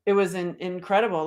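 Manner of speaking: usual speaking voice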